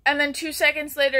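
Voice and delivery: normal talking voice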